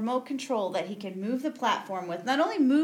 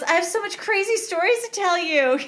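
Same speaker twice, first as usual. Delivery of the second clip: imitating excited Dale; 9 semitones higher, 5 dB louder than usual. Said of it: "She goes".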